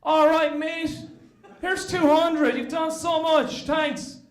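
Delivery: breezy Aussie thanking him; Australian accent. Retold or imitated. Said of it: "the Australian cousins were".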